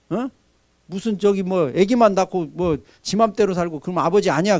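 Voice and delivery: ordinary delivery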